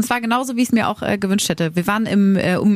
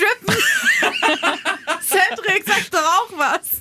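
laughing shriek; 11 semitones higher, slower than usual. Real, not acted. Teasing the host about stripping, laughing high. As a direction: squeal with laughter, then urge Cedric.

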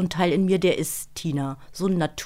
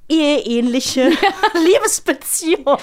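high and loud, breaking into a laugh mid-word; 8 semitones higher, 7 dB louder than usual. Real, not acted. Laughing while calling the romance marriage-like. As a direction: gush it out, laughing at the idea.

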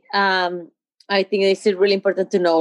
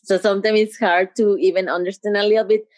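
ordinary talk, nothing marked

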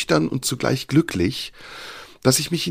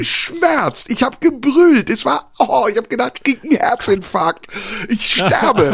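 excited recounting; 10 semitones higher, 4 dB louder than usual. Retold or imitated. Retelling painful cold plunge.

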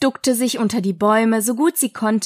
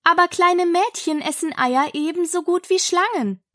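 put-on higher-pitched child's protest; reasoning Alice; 7 semitones higher than usual. A narrator's reading. Alice defends little girls eating eggs, protesting. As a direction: argue it earnestly.

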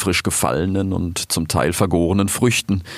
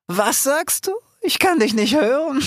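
high, teasing pretend deafness; mock him as if the crowd drowns him out; mocking lioness. Aisha teasing Fennec over the roaring crowd.

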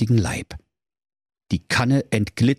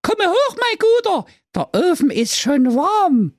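very high storybook voice, calling up; put-on character voice; 4 dB louder than usual. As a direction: pitch it way up, inviting the guest in. Figure invites guest up, put-on voice. (hohe, verstellte Märchenfigurenstimme)

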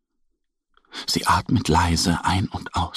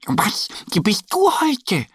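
much higher, whispered question; curious high whisper; Annie the duck asking; 12 semitones higher than usual. Acted. Annie asks her friend Mo, high whisper. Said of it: "fragt Annie".

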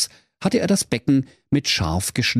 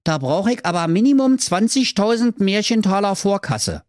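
Höke's voice; 10 semitones higher than usual. Acted. Höke fordert Vorkasse.

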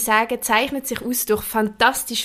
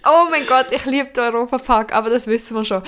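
usual speaking voice

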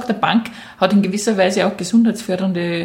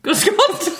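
high-pitched mock-polite hello; overpolite greeting; 11 semitones higher than usual. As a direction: open the heist with a dainty, high greeting. Demonstrates a robber's polite first line.

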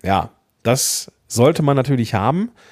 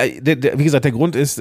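ordinary talk

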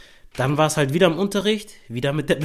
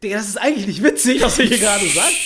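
stunned inner comment; 7 semitones higher, 5 dB louder than usual. Retold or imitated. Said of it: "ich denk mir so".

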